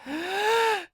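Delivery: sharp squeaky intake of breath; squeaky gasp; high gasp. A narrator's reading. Pinkie gasps.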